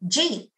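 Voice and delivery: "ji" as one clipped syllable for "did you eat"; New York fast speech. Shown as a demonstration. Lecturer isolating the syllable "ji" for students, clipped. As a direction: say "ji" as a single quick syllable. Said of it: "that first syllable".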